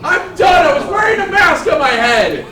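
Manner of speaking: loud, breaking into laughter; laughing shout. Real, not acted. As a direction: shout it out while cracking up.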